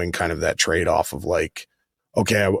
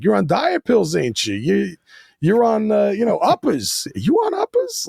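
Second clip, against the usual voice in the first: much higher, louder New York accent with its cadence; 13 semitones higher, 3 dB louder than usual. Shown as a demonstration. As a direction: mimic the Leto New York lilt. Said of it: "there's a cadence to that kind of speaking".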